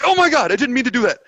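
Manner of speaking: high loud panicked blurt. Retold or imitated. Panicked retold inner reaction.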